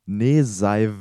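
"Neseiv" read out as a reversed word, nezaɪ; backwards speech. Shown as a demonstration. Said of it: "Mit dem Spiel Wörter rückwärts". (rückwärts gesprochen: "Neseiv")

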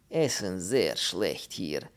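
slow, heavy foreign voice in broken German; slow Polish character voice. Acted. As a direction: drag the words out like a doleful Pole. Pole speaking broken German.

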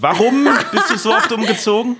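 playful nagging repeat; insistent repeat. Acted. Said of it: "drittes Mal frage ich noch".

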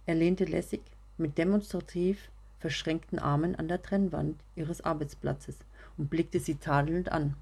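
usual speaking voice

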